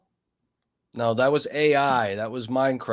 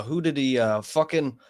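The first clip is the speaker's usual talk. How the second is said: normal talking voice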